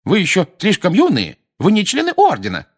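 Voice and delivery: thin, high mocking mum voice; mimicking mother. A narrator's reading. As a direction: ape Mrs Weasley's scolding. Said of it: "произнёс Фред тонким голосом, страшно похожим на материнский". (передразнивая мать тонким голосом)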